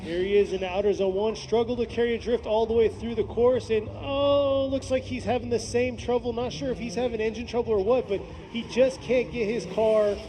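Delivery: live play-by-play. Real, not acted. Commentator calling a struggling drift run, lively play-by-play. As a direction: call the run as it happens.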